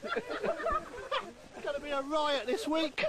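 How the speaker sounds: slow, high, laughing through the words; real laughter spilling over the line. Real, not acted. Comedian cracks up after his swing joke, laughing.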